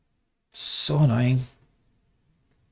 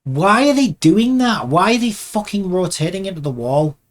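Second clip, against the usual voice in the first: exasperated; 4 semitones higher than usual. Real, not acted. Animator watching arms rotate into the wall, exasperated. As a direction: demand an answer in angry disbelief.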